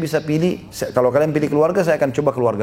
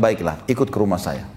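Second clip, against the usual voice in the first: calm invitation; 8 semitones lower than usual. Acted. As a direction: gently lead him home. Prophet calmly invites the man.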